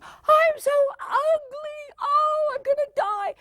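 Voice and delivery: put-on high, drawn-out teenage wail; distraught teenager. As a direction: ham up a teenager's despair, very high and slow. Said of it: "you look at them and they go".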